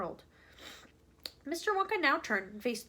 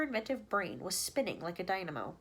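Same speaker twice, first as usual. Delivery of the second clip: plain reading voice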